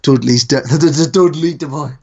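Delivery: teasing imitation of a Dudley accent; mock Dudley accent. Co-host teasing the other about his voice, mock Dudley accent. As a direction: poke fun at his Dudley voice. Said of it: "make fun of my voice".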